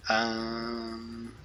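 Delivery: ordinary delivery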